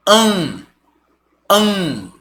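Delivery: AN held in the nose; nasal vowel. Shown as a demonstration. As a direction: sustain AN nasally, no clear N. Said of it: "só que agora no nariz".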